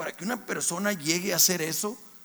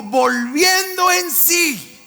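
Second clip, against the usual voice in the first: slow call-and-response; 8 semitones higher, 8 dB louder, much slower than usual. Shown as a demonstration. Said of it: "Digan conmigo".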